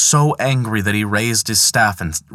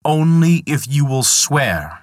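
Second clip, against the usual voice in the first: slow, higher commanding eagle; 6 semitones higher, slower than usual. Acted. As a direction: lay down the condition slowly. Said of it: "said the eagle".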